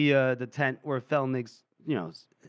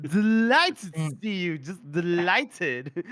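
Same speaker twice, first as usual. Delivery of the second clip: put-on slow, stretched-out drunken delight; drunk colonel; 2 semitones higher, slower than usual. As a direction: drag the words out tipsily. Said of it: "and he's, like, drunk".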